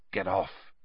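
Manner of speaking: soft, low-key grumble; murmured. A narrator's reading. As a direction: murmur it under the breath. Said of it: "he murmured".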